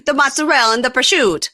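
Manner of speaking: high, loud Italian-American food cry; mock Italian-American relish. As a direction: pile on a hammed-up Italian-American accent. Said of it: "it couldn't be more stereotypical".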